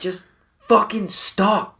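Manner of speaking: very high, slow exasperated demand; fed-up plea. Retold or imitated. Friend begging drunk buddy to stop, high slow exasperation.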